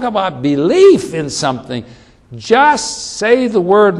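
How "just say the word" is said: high, loud and slow; pleading centurion. Acted. Centurion urging Jesus to speak the word, slow loud plea. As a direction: lean hard into the plea.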